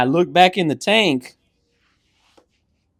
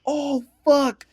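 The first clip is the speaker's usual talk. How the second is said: high shocked swear; shocked curse; 13 semitones higher than usual. Retold or imitated. Seeing the hamster fall, high shocked swear. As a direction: blurt it in alarm.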